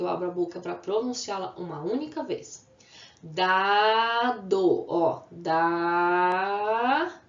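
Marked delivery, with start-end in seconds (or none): 3.2-4.9 Dado loud, as a long Da, then do; syllable by syllable. Shown as a demonstration. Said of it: "Quantas vezes eu abri a boca?". 5.3-7.1 Dado with a very long Da, gap, then do; syllable by syllable. Shown as a demonstration. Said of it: "Quantas vezes eu abri a boca?".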